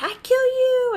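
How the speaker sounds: high shouted war cry; kid's battle yell. Retold or imitated. Mom imitating her son's battle cry, shouted high. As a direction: yell it like a little warrior.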